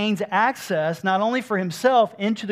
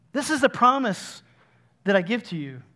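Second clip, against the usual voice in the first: usual speaking voice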